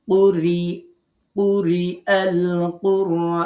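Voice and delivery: slow, loud chant with light "r" in কুরি, long final vowel in কুরআন; thin ra. Shown as a demonstration. Teacher showing learners an unrounded ra, slow chanted কুরি with thin "r". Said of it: "একেবারে স্বাভাবিক".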